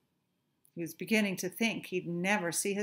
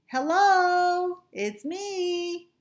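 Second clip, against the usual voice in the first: high, louder call to friends; 11 semitones higher, 7 dB louder, much slower than usual. Acted. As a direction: call out brightly to find your friends. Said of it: "he called".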